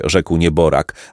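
ordinary delivery

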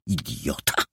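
low-voiced, quiet insult; contemptuous mutter. Jola dismisses the boy's retort, contemptuous mutter. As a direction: drop the voice and mutter it scornfully.

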